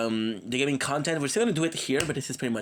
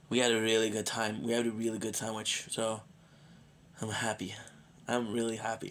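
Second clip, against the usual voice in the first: worn out; 2 semitones lower, 6 dB quieter than usual. Real, not acted. Streamer ending the night after a good sandwich, tired and quiet. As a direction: wind down quietly, pooped but content. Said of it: "completely pooped".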